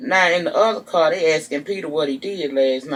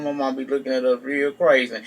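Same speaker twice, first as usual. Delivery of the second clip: ordinary talk, nothing marked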